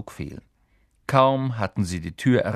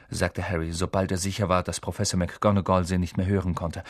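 ordinary delivery, nothing marked

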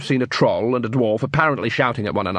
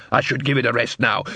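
plain reading voice

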